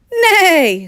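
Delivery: high, loud eager whinny; excited high whinny; excited neigh. Acted. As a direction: neigh with delight. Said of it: "said the little chicks with excitement".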